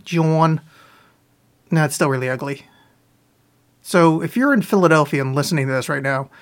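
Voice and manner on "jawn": JOHN said as Philadelphian "jawn"; lightly imitated Philadelphian accent on JOHN. Shown as a demonstration. Streamer showing an ugly slang word, JOHN in Philadelphian accent. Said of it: "if you say it in the Philadelphian accent".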